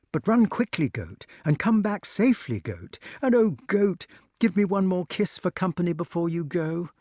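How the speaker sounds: high, pleading farewell; distressed, clinging goodbye; broken voice. A narrator's reading. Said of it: "said the wart in a broken voice".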